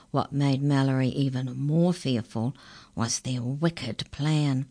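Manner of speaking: ordinary delivery, nothing marked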